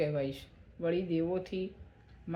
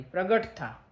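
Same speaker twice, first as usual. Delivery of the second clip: short, louder narrated command; enraged yell; 5 dB louder, slower than usual. A narrator's reading. Furious Brahma commanding a being to appear, enraged yell. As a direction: call it out in contained anger. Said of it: "ક્રોધે ભરાયેલા બ્રહ્માએ રાડ પાડી".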